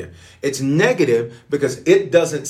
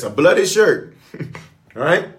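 ordinary talk, nothing marked

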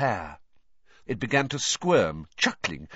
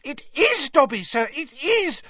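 very high, delighted squeal; excited little creature voice; squealing elf; 5 dB louder than usual. A narrator's reading. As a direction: confirm it in an overjoyed elf squeal. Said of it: "squealed the voice".

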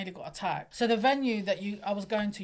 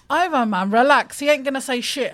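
louder, telling him to chill; loud mock reassurance; 4 semitones higher, 11 dB louder than usual. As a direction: shout him down to calm him. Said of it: "And I was like".

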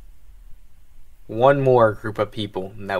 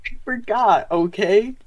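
squeaky voice; 10 semitones higher than usual. Acted. Squeaky back and forth.

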